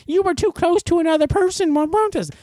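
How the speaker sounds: very high-pitched silly official voice; mock health official. Health department ticketing a protester, high mock-official voice. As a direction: ticket someone in a squeaky officious put-on voice. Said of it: "Health department's not out there issuing tick".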